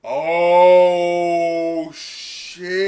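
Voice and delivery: loud startled outburst; sudden real surprise; shocked. Real, not acted. Real shock at the anime scene.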